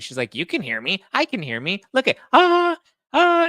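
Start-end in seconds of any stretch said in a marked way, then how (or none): 0.3-1.8 imitating oblivious mother. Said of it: "then she starts screaming". 1.9-3.5 high, loud test screams; piercing mock shriek; imitated screaming. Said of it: "she's, like, screaming".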